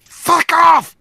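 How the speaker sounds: high, loud shriek; screaming. Pretend. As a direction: shriek it at them. Snapping at someone.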